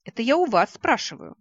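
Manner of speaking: loud, high, showy retort; showing off. A narrator's reading. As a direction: play it up for the audience. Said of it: "откровенно рисуясь".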